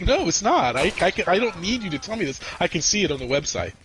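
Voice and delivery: mock argument, starting high and easing down; retold exasperation. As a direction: re-enact arguing with a useless machine. Retold argument with phone robot.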